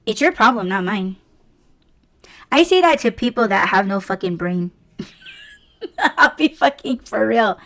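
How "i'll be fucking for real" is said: real laugh breaking into the words; higher, laughing through the admission. Real, not acted. Laughs at own blunt remark.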